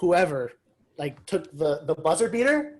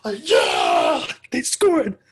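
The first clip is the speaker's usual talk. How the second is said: loud shouted cheer; excited cheering; 7 semitones higher, 9 dB louder, much slower than usual. Retold or imitated. Fan cheering as the Raptors score. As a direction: yell like the team just scored. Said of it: "literally, I was like".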